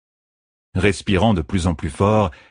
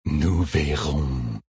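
very slow, softer, gloating; sly fox creature; 3 semitones lower, 4 dB quieter, much slower than usual. A narrator's reading. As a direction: savour the words like prey. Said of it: "dit un des renards".

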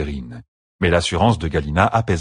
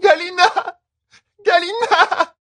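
high sobbing calls of a name; tearful, broken pleas; slower than usual. A narrator's reading. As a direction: break down crying as she calls. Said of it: "elle éclata en sanglots".

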